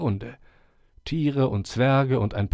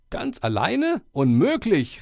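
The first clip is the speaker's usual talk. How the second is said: called out; 10 semitones higher, 4 dB louder, slower than usual. Acted. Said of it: "riefen sie".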